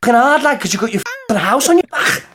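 acted Scouse confrontation; Scouse accent. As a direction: square up to someone in put-on Scouse. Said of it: "impression of the man getting out of the car".

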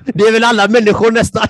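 laughing through the line; a joking aside, laughed out. Real, not acted. Interviewer laughing at the guest's remark.

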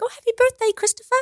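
high, soft, lilting mum impression; imitating mum. Daughter mimicking mum's Skype birthday wish, high imitated mum voice. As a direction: put on mum's high-pitched birthday greeting. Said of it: "that's what my mom goes".

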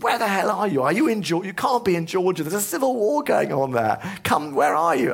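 high, frantic worried mother; alarmed mum. Retold or imitated. Mum on the phone hearing of civil war, high frantic alarm. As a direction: squeal it out like a panicked parent. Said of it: "um, she said".

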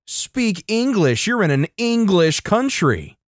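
high, drawn-out mock shriek; hammed-up indignant screech. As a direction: shriek it slowly without real volume. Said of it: "Entitled Mother still shrieking".

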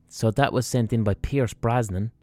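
ordinary delivery

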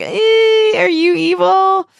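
high, drawn-out voice of suspicion; wary squeal. Retold or imitated. Cordelia wary of a hugging Harmony.

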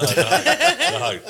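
laughing hard, high and stretched out; cracking up mid-story; squealing no through laughter. Real, not acted. Laughing while telling the story.

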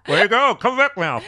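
high, loud mock call. Co-host calling back whoever is leaving, high shout. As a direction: call them back loudly.

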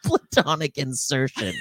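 giggling through the words; laughing. Real, not acted. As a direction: laugh while repeating it.

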